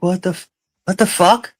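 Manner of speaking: high, stunned what the fuck; shocked disbelief. Retold or imitated. Pulling up at her parents' pig farm, in stunned disbelief. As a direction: gasp it out in shock at the hog farm.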